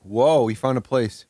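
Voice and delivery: ordinary delivery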